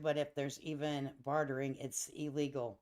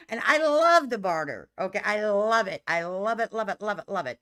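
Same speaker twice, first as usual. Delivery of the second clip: emphatic, rapid-fire enthusiasm; gushing; 4 semitones higher, 7 dB louder than usual. Real, not acted. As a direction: gush it, loud at first then easing off. Gushing about loving to barter.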